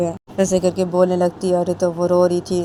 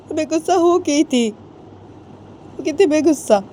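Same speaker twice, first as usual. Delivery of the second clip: high, emotional mother's voice; mother explaining; 7 semitones higher than usual. Acted. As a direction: plead she spoke in anger. Mother explains her anger to daughter. (बेटी को समझाती माँ, ऊँची भावुक आवाज़)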